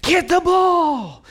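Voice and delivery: high, loud, drawn-out shout; sideline yelling. Retold or imitated. Both sidelines to the five-year-old, yelling. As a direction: imitate a parent hollering at the field. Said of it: "are yelling".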